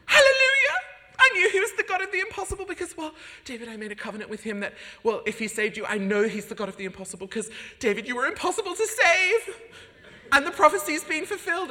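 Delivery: high, loud, fast rejoicing; ecstatic mum. Retold or imitated. Opera-singer mum rejoicing over his conversion, ecstatic. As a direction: erupt in operatic triumph. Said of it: "opera singer, so it's always dramatic".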